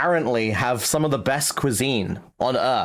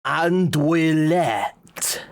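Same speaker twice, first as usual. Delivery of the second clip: andouillette drawn out slowly in a hammed-up French accent; mock French accent; much slower than usual. Reading French dishes aloud.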